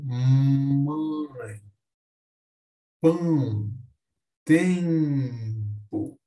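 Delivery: "mãe, pão, tempo" drawn out with nasal vowels; som nasal. Shown as a demonstration. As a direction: stretch "mãe", "pão", "tempo" through the nose, pausing between. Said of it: "pelo nariz sai um som nasal". ("mãe, pão, tempo" alongados, pelo nariz)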